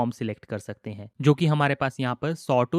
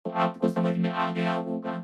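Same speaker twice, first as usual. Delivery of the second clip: synthetic, robotic vocoded speech; vocoder effect. Shown as a demonstration. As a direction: talk as a keyboard-driven vocoder. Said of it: "vocoder effect मिल रहा है".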